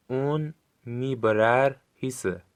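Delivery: slowly, syllable by syllable; drawn out for learners to copy; slow careful model. Shown as a demonstration. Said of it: "خوب گوش کنید و با صدای بلند تکرار کنید".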